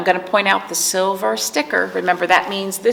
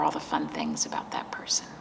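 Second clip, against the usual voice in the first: whisper; 10 dB quieter than usual. Real, not acted. Comforting children about loss.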